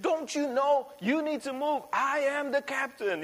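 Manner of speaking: mock-furious captain shouting high; hammed-up indignant captain demanding the ship move; fuming captain. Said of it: "really fuming".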